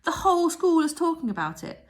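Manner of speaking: high, loud and laughing; excited. A narrator's reading. Maud bouncing on the bed, telling Mildred: high excited laughing voice. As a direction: bubble over with the news.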